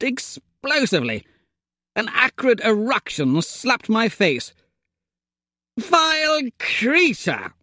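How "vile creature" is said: very high, slow outraged cry; raging. A narrator's reading. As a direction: spit out the insult in a rage. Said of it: "I raged".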